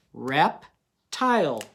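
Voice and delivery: "Reptile" drawn out slowly, both syllables clear; slow sounding out. Shown as a demonstration. Teacher sounding out a word slowly for young readers. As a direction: stretch "Reptile" so each syllable can be heard. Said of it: "what's the base word of reptiles".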